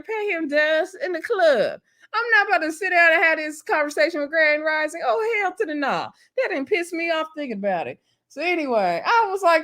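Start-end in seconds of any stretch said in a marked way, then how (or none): 0.0-6.1 high, whiny mock-outrage; hammed-up indignation; worked up. Real, not acted. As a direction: push it up high and incredulous. Riled up about the men.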